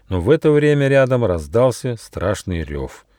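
usual speaking voice

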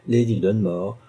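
plain reading voice

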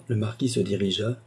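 usual speaking voice

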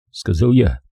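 ordinary delivery, nothing marked

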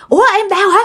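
high, quick faked panic; mock alarm. Father pretending to panic to his wife, quick and high. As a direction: pretend to fuss over a wife in labor. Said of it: "ba mới giả vờ".

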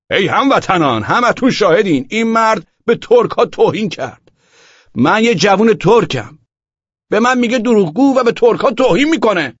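high, loud rabble-rousing cry; rally bystanders with an outraged shout; loud, indignant public accusation; shouting to the crowd. A narrator's reading. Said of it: "فریاد زنان گفت".

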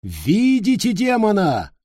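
high preacher's cry to the crowd; shouted. A narrator's reading. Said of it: "прокричал он". (возглас проповедника)

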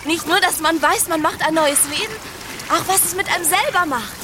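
bubbling over with happiness on the beach; joyful, giddy. Acted. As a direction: pregnant wife teasing her husband, giddy and bright. Happy wife at the beach.

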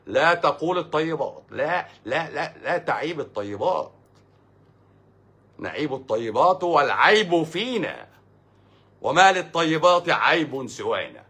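slow, high, ringing recitation; stretched, emphatic formal delivery; declaimed verse. Acted. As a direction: proclaim it like classical poetry. Declaims a parodied classical verse.

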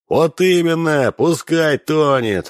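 high, slow shouted cheer; mob shout. A narrator's reading. Cossacks cheering that the princess should drown, slow high shout. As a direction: yell it together like a mob. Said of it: "закричали товарищи казаки".

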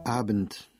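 ordinary delivery, nothing marked

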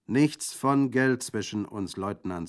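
loud, slow, firm refusal; decided tone. A narrator's reading. Bandit refusing Orso's purse, decided. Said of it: "in entschiedenem Ton".

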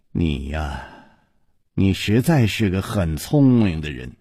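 low, sighing, measured address; regretful villainess. A narrator's reading. Yinji sighing over clever Chu Liuxiang, low regret. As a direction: speak low, as if sorry for him.

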